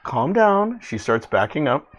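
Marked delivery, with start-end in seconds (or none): 0.0-0.8 high character voice; high-voiced reply to a threat; Kellis pleading calm. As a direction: pitch it up to calm someone threatening. Game master answers as Kellis.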